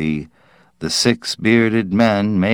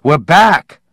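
much higher, loud hail; shout; 8 semitones higher, 7 dB louder than usual. Acted. Parkhurst hailing the kids across the field, loud shout.

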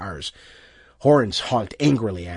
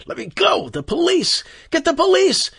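frantic shouting; 4 dB louder than usual. A narrator's reading. Struggling loose from the crowd.

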